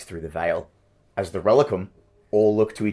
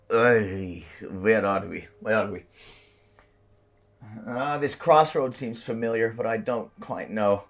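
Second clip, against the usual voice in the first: tiefling voice; 2 semitones higher, slower than usual. Acted.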